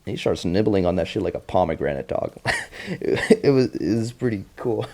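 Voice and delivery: ordinary talk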